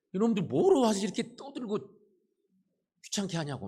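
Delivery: put-on loud, very high furious yelling at the kids; angry scolding. Irritated disciples yelling at pestering children, furious loud scolding. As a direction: scold the noisy brats at full voice. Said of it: "하지만 분위기는 그렇죠".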